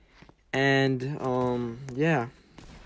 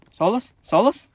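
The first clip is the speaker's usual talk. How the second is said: calling out; 6 semitones higher, 5 dB louder than usual. Real, not acted. Calling out to teammate.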